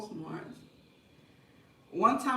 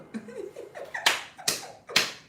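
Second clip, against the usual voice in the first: a high, breathless giggle; high breathy laugh. Real, not acted. Boasting about blocking the girl, high giggle. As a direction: cackle at her own cleverness. Laughing at her own trick.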